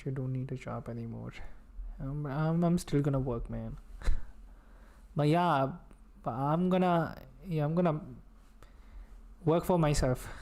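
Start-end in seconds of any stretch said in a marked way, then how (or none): none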